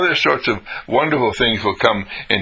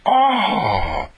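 long, high oh of wonder; incredulity; 9 semitones higher than usual. Acted. Hearing how the ice cream lasts, incredulity. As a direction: let out a drawn-out amazed oh. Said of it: "See, that's incredulity".

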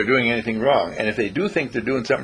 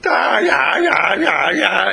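higher, louder exaggerated grumbling; mock complaining; 7 semitones higher, 4 dB louder, much slower than usual. Speaker mimicking neighbours' loud complaints, higher and louder. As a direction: ham up the annoyed neighbours. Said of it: "complain, complain".

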